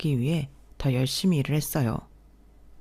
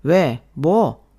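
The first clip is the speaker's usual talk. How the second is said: sharp retort; 5 dB louder, much slower than usual. Acted. Husband snapping back in a fight.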